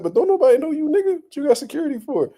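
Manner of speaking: lightly voiced high taunt; mock taunt. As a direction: imitate the rival's teasing jab. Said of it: "He said".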